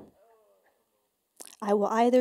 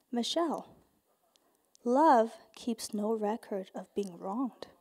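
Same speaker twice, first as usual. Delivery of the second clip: patient; 3 semitones lower, 6 dB quieter than usual. Retold or imitated. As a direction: gently correct her, voicing a patient Jesus. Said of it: "bless him, being very patient".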